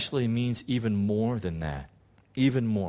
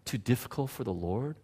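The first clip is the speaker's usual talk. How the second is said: gentle rebuke; 9 dB quieter than usual. Retold or imitated. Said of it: "the man turns and says".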